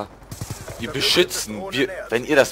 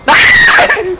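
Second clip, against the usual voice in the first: loud high panicked scream; panicking gamer; 9 dB louder than usual. Real, not acted. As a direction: scream as you lose the fight. (panischer Schrei)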